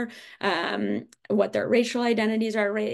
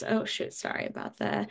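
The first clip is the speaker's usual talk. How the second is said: hushed aside; 6 dB quieter than usual. Real, not acted. Startled by alarm mid-lecture.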